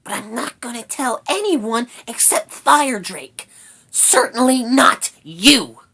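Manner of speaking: high, loud, stubborn rat voice; huffy little creature holding back news. A narrator's reading. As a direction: refuse pointedly in a squeaky voice. Rat refuses to tell Sorrel.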